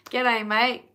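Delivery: playful put-on Australian g'day; mock Aussie greeting. Answering a viewer who hears her Australian accent, a mock Australian greeting. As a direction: toss off the Australian cliché for fun. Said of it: "We don't say that".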